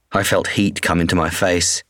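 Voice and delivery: plain reading voice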